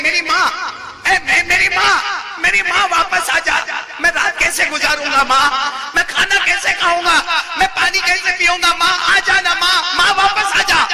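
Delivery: high, loud pleading shout for a dead mother; full-voiced, desperate mock wail; crying out loudly. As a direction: act out a bereaved son screaming after his mother. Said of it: "زور زور سے چلانے لگے".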